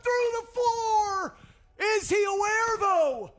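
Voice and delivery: high, loud, excited shouting call; esports caster. Real, not acted. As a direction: scream the clutch play-by-play. Caster calls the clutch.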